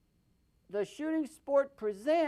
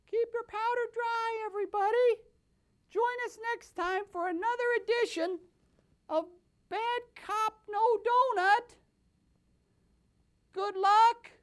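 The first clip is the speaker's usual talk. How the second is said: much higher, bright announcer caricature; sign-off character voice; 10 semitones higher than usual. Acted. The host signs off to viewers in a high cartoon voice.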